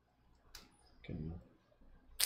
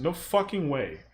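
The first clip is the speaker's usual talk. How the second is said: ordinary talk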